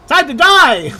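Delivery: high, loud mock battle cry; hammed-up threatening yell. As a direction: shout it as a game villain's threat. Voicing the game's battle threat.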